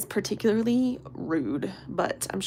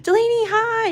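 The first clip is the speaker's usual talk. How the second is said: excited greeting; 7 dB louder than usual. Real, not acted. Greeting a viewer.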